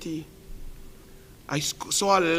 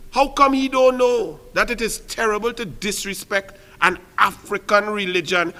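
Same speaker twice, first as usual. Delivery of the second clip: ordinary talk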